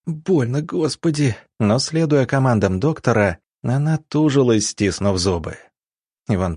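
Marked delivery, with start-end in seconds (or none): 0.1-1.4 pained cry. Acted. Woman in labour cries in pain.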